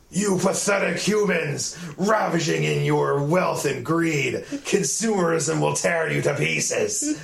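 slow, high, hammy villain speech; overacted anime dub. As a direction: ham up the villain rant slowly. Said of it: "he's like".

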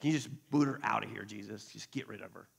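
low, soft grumble to Jesus; muted mock complaint; disciples' retold complaint. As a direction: grumble under your breath to get someone removed. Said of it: "the disciples are like".

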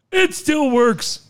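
slow indignant protest; caller protests. Pretend. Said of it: "caller protests".

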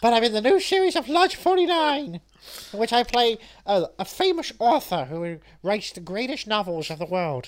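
high, loud, hammed-up actor; boastful actor impression. Actor plugging his new series, hammed-up boast. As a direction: brag loudly in a put-on voice.

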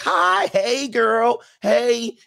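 high, loud, gushing hello; hammed-up girly squeal of greeting; women greeting girlfriends. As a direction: greet your girlfriends in a mock-excited high voice. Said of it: "this is how they talk to their female friends".

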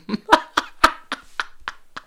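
high, breathy burst of laughter; real laughing fit. Real, not acted. Man cracking up at his own attempt at a long word, laughing fit.

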